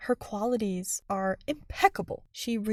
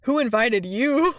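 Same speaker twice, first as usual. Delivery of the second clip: slow, louder snide question; rude Marinette; 5 dB louder, slower than usual. Retold or imitated. Marinette snubs Sabrina at the door, slow and snide. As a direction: sneer it out. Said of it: "Marinette is like".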